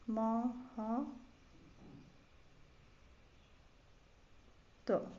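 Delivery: महत्व drawn out with long gaps; spelled by syllables. Shown as a demonstration. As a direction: dictate महत्व very slowly, piece by piece. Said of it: "महत्व कैसे लिखा जाएगा".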